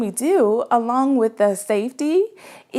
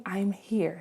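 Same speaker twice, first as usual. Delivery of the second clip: much softer, slow and gentle; gentle hush; 3 semitones lower, 9 dB quieter than usual. Shown as a demonstration. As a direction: murmur it so as not to disturb. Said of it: "I speak in that same way".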